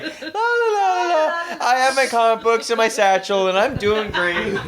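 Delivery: mock-oblivious humming, laughing; carefree singsong Dave. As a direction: sing it like you haven't a care in the world. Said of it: "he's just like".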